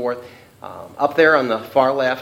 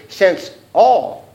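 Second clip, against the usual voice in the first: "all" punched high and loud; stress on all; 6 semitones higher, 5 dB louder than usual. Shown as a demonstration. Teacher reading a verse to the class, stress on "all". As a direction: lean hard on the word "all". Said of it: "Underline that word".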